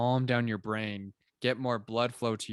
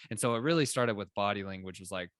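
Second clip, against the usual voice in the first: normal talking voice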